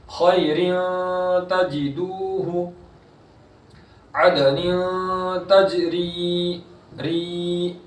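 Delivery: slow, drawn-out Quranic chanting; tajweed recitation. Shown as a demonstration. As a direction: stretch the vowels in recitation. Recites example.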